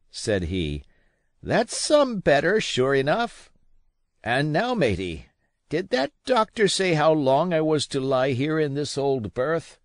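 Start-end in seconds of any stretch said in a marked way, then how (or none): 1.4-9.8 high, coaxing sea dog; an old sailor fishing for news; wary, higher old pirate; old captain. A narrator's reading. Captain asks about bedrest.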